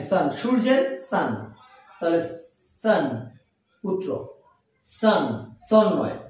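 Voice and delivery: ordinary talk, nothing marked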